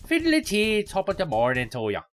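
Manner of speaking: loud, hammed-up stage Irish; cartoon Irish greeting. Shown as a demonstration. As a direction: do a clichéd Irish accent, starting high. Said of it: "that's my Irish accent. Very stereotypical".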